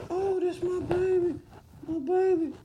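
put-on high, quiet cooing over a baby; mock parent. Vlogger mocking parents receiving their baby, high cooing. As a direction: coo like a proud new mother.

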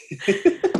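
high, short laugh. Real, not acted. Laughs after reply.

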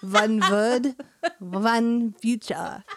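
W turned to V, drawn-out German accent; exaggerated practice German accent. Shown as a demonstration. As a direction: say "One" as "Vun" in a slow German accent. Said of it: "are pronounced as a V".

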